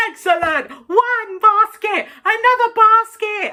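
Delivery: mock excited presenter, slow on one basket; imitating the presenter. Imitating the host cheering every basket. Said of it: "He kept saying".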